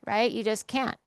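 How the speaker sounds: usual speaking voice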